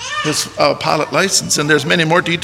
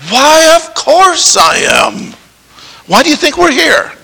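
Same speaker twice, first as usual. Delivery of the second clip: eager groom; 9 semitones higher, 3 dB louder than usual. Retold or imitated. Groom answering the bishop in his heart, eager. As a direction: play the groom's eager inner voice. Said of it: "you're already saying in your heart".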